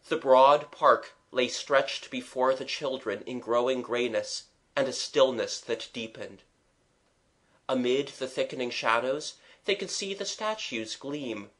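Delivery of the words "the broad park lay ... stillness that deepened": usual speaking voice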